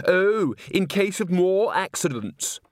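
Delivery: high, slow, mocking drawl; sarcastic chorister. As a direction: drag out the sneer. Sarcastic chorus member.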